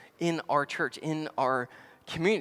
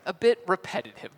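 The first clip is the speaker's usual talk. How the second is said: ordinary delivery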